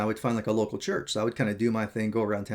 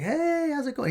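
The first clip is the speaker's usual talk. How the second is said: awkward friendliness; 5 dB louder than usual. Retold or imitated. Awkward stranger greeting him from the pew, overly friendly. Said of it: "so awkward".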